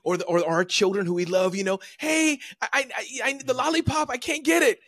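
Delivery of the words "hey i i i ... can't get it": put-on high begging child's voice, pitched way up; whiny child. Parent voicing a child begging for a lollipop, high whine.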